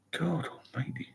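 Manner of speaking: breathy unvoiced whisper of disbelief; whispered exclamation. Real, not acted. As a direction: whisper it under your breath, shocked. Muttered reaction to heavy drinking.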